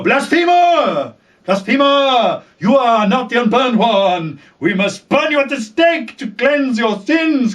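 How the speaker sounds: high, slow ranting accusation; fanatic priestess. Retold or imitated. Red Women condemning Daenerys, hammed-up fanatic rant. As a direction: denounce her like a zealot. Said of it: "they will go".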